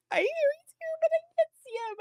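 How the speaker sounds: very high, squeaky re-enacted desperation; exaggerated fretting. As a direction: squeal it like an overwrought fan. Acts out own fretful searching.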